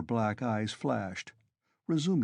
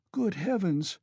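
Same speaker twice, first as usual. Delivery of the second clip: higher, a mild shocked cry; cried out; 9 semitones higher than usual. A narrator's reading. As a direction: gasp it out in dismay. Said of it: "cried Pulcheria Alexandrovna".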